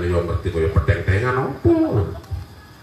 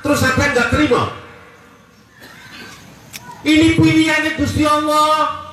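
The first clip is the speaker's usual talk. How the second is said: fiery preaching; 11 semitones higher than usual. Acted. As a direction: preach it up at full force to the crowd. Preacher rouses the congregation. (ceramah berapi-api dengan suara tinggi dan lantang)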